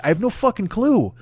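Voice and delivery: usual speaking voice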